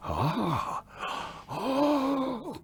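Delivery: high, drawn-out gasping oh; mock gasp of an alarmed crowd; audience gasp. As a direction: imitate a shocked audience gasping.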